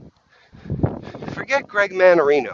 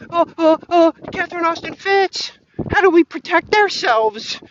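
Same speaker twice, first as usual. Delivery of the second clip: much higher, squeaky frightened voice; hammed-up frightened host; mock panic; 10 semitones higher than usual. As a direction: whimper it like a lost puppy. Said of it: "to being".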